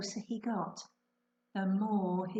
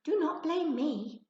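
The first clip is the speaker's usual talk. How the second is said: creature voice; 8 semitones higher, 3 dB louder than usual. Acted. Magical creature voice.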